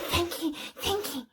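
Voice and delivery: soft, whimpering mock gratitude; grovelling Pettigrew. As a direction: whimper it like a pitiful, grovelling wretch. Acting out Pettigrew's grovelling thanks.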